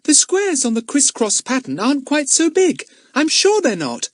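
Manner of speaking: put-on high-pitched, firm child; high, certain objection; insistent little girl. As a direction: stand your ground in a small child's voice. Plays young Betts disagreeing.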